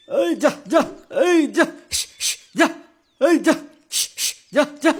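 calling out to scare off a snake; shooing call. Acted. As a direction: shout the snake away, rising.

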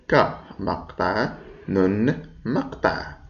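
ك مقطع then نن مقطع, each syllable said slowly and apart; syllables split apart. Shown as a demonstration. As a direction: break the word into ك and نن, slowly. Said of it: "من كم من مقطع تتكون". (تقطيع الكلمة ببطء إلى مقاطع)

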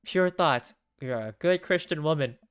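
loud, emphatic telling-off; self-scolding. Acted. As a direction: scold yourself firmly, punching each phrase. Game heroine scolds herself for thoughts.

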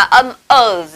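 "z" as a long sustained buzz; Z sound. Shown as a demonstration. Teacher showing students the second sound, "z" held long. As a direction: hold the "z" out as a pure consonant. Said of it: "kết hợp với âm z".

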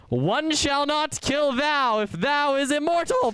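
high, slow, hammed-up proclamation; mock-archaic decree.

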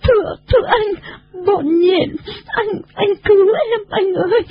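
very high, stammering tearful voice; sobbing plea. A narrator's reading. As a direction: beg for rescue between acted sobs. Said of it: "bù lu bù loa". (giọng cao, nức nở cầu cứu)

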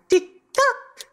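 high cheeky squawk; high parrot squawk. A narrator's reading. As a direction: chirp it like a taunting bird. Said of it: "And then it added".

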